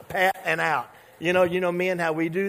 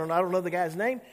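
normal talking voice

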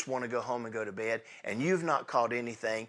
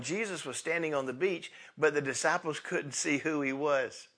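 normal talking voice

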